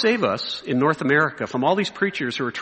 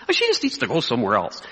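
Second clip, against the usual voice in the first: lower, offhand brush-off; casual dismissal; 4 semitones lower than usual. Retold or imitated. Minister talking about a troublesome church member, casually dismissive. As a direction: shrug off the critic without bother.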